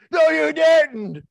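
high loud drawn-out shout; shocked shout. Acted. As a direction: yell it slow in disbelief. Sister's shocked reply in story.

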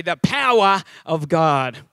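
slow, broad Australian "The power of God"; Australian accent. Shown as a demonstration. Pastor inviting the church to repeat, in Australian accent. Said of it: "getting my Australian accent back".

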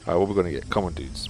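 ordinary delivery

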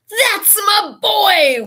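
loud, high, stretched-out cheer; exultant yell. A narrator's reading. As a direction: yell it slowly with pride. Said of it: "Wood kept yelling".

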